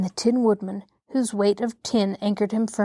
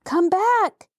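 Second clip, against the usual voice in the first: high, louder shout upward; boy's shout; 10 semitones higher, 4 dB louder than usual. A narrator's reading. Boy ordering the gump down from the sky, shouting. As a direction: call it back sharply into the air. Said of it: "shouted the boy again".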